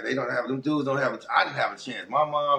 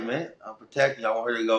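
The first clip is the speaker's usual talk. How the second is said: normal talking voice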